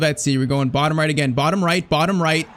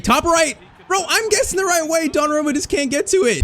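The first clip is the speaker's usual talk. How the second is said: frantic excitement; 11 semitones higher than usual. Real, not acted. Gamer reacting to a penalty he guessed right, frantic yell. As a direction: shout it as the ball flies past.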